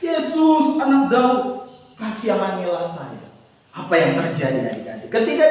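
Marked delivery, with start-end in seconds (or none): none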